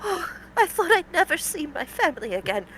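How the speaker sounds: a captive near crying, voice breaking; tearful villager fighting back tears. Acted.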